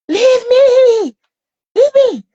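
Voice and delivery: very high, loud, drawn-out shriek; imitated screaming. Mother imitating her son during the blood test, screaming. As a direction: scream it like a child pinned down, fighting to get free. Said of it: "then started screaming".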